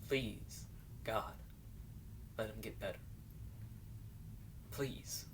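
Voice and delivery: hushed, pleading prayer; very soft plea to God, long pauses between phrases; quiet prayer. Acted. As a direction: pray softly under your breath for a sick child. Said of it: "and pray".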